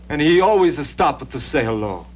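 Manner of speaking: ordinary talk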